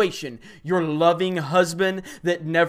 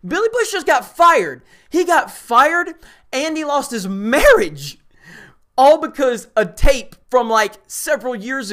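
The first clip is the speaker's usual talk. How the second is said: much higher and louder, incredulous; indignant high-pitched outburst; outraged shout; 6 semitones higher, 5 dB louder than usual. Real, not acted. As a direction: yell it in disbelief at the injustice. Outraged rant about firing.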